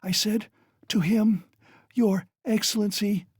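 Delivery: high, lightly gasped words; a hint of gasping fever; gasping delirium. A narrator's reading. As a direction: push each word out on a breath. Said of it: "gasping after each word".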